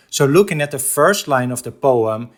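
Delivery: ordinary talk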